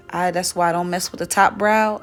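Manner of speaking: ordinary talk, nothing marked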